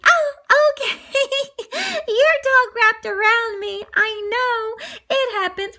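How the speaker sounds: put-on high-pitched young woman; high girly voice. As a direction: play Anita, high and sweet. Anita voiced in story.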